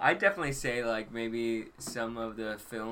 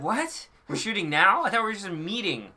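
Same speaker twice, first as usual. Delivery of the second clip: high, louder mock disbelief; acted-out bewilderment; 7 semitones higher, 6 dB louder than usual. Actor expecting just a meeting with the director, mock bewilderment. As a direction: replay being caught completely off guard. Acts out his surprise at shoot.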